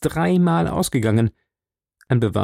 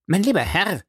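exclaiming; 7 semitones higher than usual. A narrator's reading. Doctor protests to the baronet about the boot, exclaiming. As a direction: mildly exclaim in a raised pitch. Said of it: "rief Doktor Mortimer".

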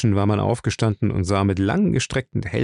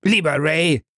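sneering repeat of lieber, higher; mocking echo; 5 semitones higher than usual. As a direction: mimic him with scorn. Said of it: "spottete der andere". (spöttisch nachäffend)

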